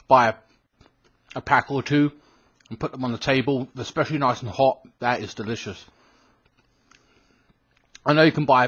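talking between bites; chewing while talking. Real, not acted. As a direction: mouth full of cookie. Seen 2: Bites the cookie repeatedly, held at mouth throughout.

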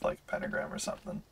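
fast whispered throwaway; whispered aside. Real, not acted. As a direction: whisper it quickly under your breath. Quick muttered aside.

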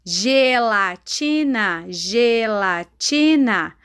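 syllable by syllable, very drawn out; half-speed teacher modelling of a word; slow dictation. Shown as a demonstration. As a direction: sound it out slowly for children to write. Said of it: "igual a Pro está falando".

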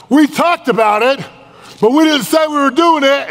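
very high, loud comic squawk of protest; mock exasperated wife. Wife arguing back over the spent money, mock exasperation. Wife's retort in acted quarrel.

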